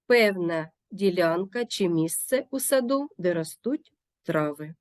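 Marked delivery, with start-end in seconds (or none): none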